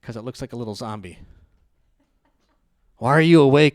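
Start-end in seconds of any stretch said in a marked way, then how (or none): 3.0-3.8 loud startled question to a sleepwalker; startled shout. Retold or imitated. Sibling finding the sleepwalker, startled shout. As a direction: blurt it out loud, spooked.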